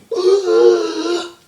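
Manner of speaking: very high, loud drawn-out cry; mock battle scream. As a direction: let out a hammed-up high scream. Molly's cry in the fight.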